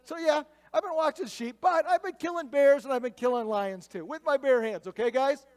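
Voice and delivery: loud, high, fired-up David retort; hammed-up indignant bragging; defiant boast. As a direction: brag it loudly to the doubters. Acts out David boasting to doubters.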